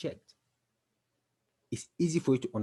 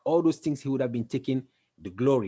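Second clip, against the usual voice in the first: ordinary delivery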